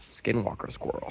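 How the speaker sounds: whispered, spooked aside. Retold or imitated. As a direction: breathe it out like a secret. Spotting a creepy squirrel while high.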